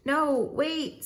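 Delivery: high mock scream, not very loud; screamed protest. A narrator's reading. Patient about to be shocked, crying out high in alarm. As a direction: plead in hammed-up alarm. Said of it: "screamed Lightning".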